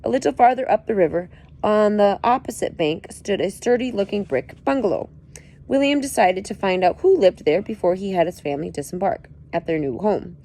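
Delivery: plain reading voice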